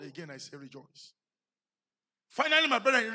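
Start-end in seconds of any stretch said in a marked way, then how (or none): 0.0-1.1 hushed. Acted. Quoting Paul, dropping away.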